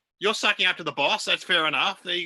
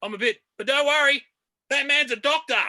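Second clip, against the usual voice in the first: high, loud mock-pained call; hammed-up cry from the floor; hurt man calling out; 8 semitones higher, 5 dB louder than usual. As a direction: imitate an injured man shouting to onlookers. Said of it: "hurt himself ... and he's like this".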